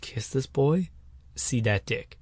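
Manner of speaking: normal talking voice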